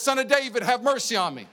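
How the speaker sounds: high, loud cry for mercy; loud plea. Retold or imitated. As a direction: call out to the healer, pleading. Church crying out to healer.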